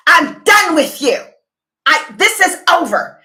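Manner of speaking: imitating an angry woman, high and loud; angry breakup line. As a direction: fling it at him like she's walking out. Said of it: "So when she gets angry".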